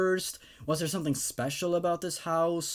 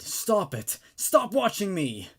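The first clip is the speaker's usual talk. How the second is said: shouting; 2 semitones higher than usual. Acted. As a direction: yell at the vision to stop. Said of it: "Lizzie shouted".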